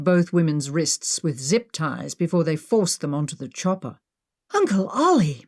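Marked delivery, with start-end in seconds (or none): 4.5-5.4 a milder, urgent exclamation; concerned exclamation; exclaimed. Acted. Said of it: "Maggie exclaimed".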